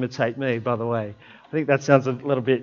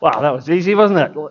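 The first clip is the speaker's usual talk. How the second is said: mock relief; 4 dB louder than usual. Preacher mocking a quick fix with sarcastic relief. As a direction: tease the congregation with mock relief. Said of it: "it's all fixed".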